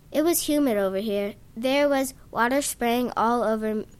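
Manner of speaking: ordinary delivery, nothing marked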